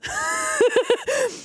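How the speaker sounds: high, helpless run of giggles; real high-pitched laughing fit; laughter. Real, not acted. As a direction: crack up at your own joke. Cracks up after her own jibe.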